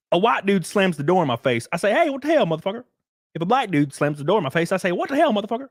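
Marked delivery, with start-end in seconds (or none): 1.9-2.8 high-pitched mild mock outrage; acted indignant outburst. Imagined reply to a door slammer, high mock indignation. 4.9-5.7 high-pitched mild mock outrage; acted indignant outburst. Imagined reply to a door slammer, high mock indignation. Same reaction, shown as identical.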